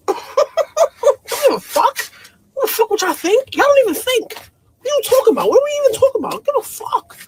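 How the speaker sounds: high-pitched, laughing disbelief; incredulous laughing. Real, not acted. Woman scoffing at men's opinions of her, high and laughing. Scoffs at caring what men think.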